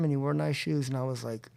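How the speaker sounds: ordinary talk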